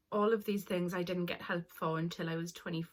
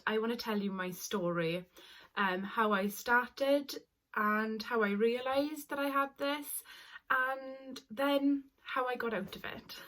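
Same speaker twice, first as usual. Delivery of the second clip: ordinary delivery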